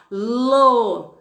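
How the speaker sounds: "lo" drawn out slowly, both mouth moves clear; slow syllable demo. Shown as a demonstration. Teacher slowing the syllable so pupils hear two sounds. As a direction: stretch "lo" so the class hears two sounds. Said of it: "Você não percebeu que são duas boquinhas".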